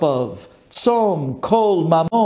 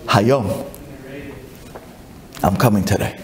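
Mashiach's calm answer; 9 semitones lower, 4 dB quieter than usual. Acted. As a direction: answer from deep inside, unhurried. Mashiach answers Rabbi Yeshua quietly.

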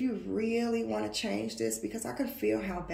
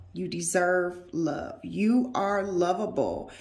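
slow, loud, firm affirmation; emphatic affirmation; 5 dB louder, slower than usual. Acted. Reader repeating the cards to the viewer, slow and firm. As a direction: press the words home.

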